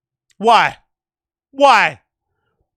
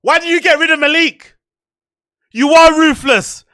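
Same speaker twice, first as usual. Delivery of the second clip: angry accusation; 7 semitones higher, 6 dB louder than usual. Real, not acted. Streamer accusing the podcaster of firing Malik, loud and angry. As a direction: hammer the accusation home.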